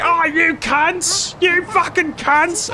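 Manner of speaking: very high, slow screaming rage; yelling Az impression. Imitating his friend Az as a high, slow yelling madman. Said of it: "my impression of you".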